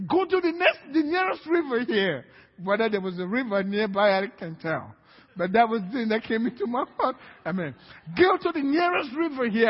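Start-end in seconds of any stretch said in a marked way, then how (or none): 0.1-2.2 higher, louder ordering it away; commanding order. Retold or imitated. Preacher ordering the demon to the river, raised and commanding. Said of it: "And I said". 8.2-9.7 higher, louder ordering it away; commanding order. Retold or imitated. Preacher ordering the demon to the river, raised and commanding. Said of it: "I said".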